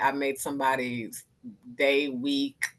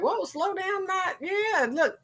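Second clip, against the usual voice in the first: high "whoa, slow down"; calm them down; slower than usual. Retold or imitated. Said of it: "You're just like".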